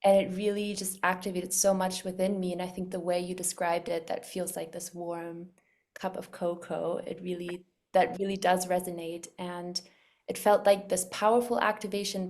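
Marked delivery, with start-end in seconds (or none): none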